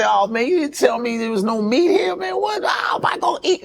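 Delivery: high-pitched, hammed-up protest; joking mock complaint. Date teasing her about the meatless restaurant, mock outrage. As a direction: playfully whine in disbelief, voice climbing high. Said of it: "making fun. I say".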